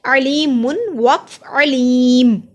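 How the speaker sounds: अलीम said slowly, the ending dropped; pausal form. Shown as a demonstration. Teacher showing a stop to students. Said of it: "अब दो पेश थी".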